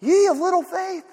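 a quiet sigh of a rebuke; gentle rebuke. Retold or imitated. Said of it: "he looked at them and he said".

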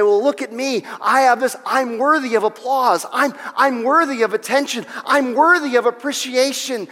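high, loud show-off bragging; mock braggart. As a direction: beg for applause like a bragging child. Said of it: "we do that because we say".

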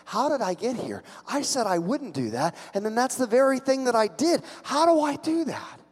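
soft self-reproach rising to high-pitched disbelief; acted anguish, growing from quiet to pleading; guilt building. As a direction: let the remorse climb in pitch to the end.

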